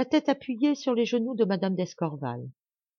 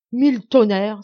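loud, raised-pitch oath; thundering soldier's oath; indignant exclamation; 4 semitones higher, 9 dB louder than usual. A narrator's reading. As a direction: burst out with an outraged curse. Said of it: "s'écria-t-il".

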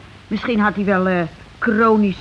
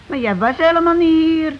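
raised bickering; 7 semitones higher, 4 dB louder than usual. Acted. Spouses bicker over alibi.

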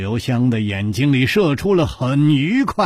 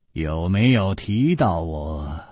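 ordinary delivery, nothing marked

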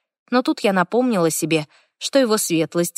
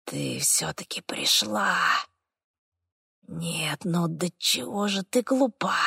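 hoarse whispering demon voice; demonic whisper; 7 dB quieter, slower than usual. A narrator's reading. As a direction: whisper it slowly from the dark. Demon speaks from darkness.

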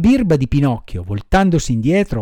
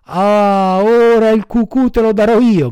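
raised, louder threatening cry; bold boy's shout; 5 semitones higher, 5 dB louder than usual. A narrator's reading. Boldest boy to the mocking puppet, shouted threat. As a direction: burst out high and loud at the teaser. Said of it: "gridò il più ardito di quei monelli".

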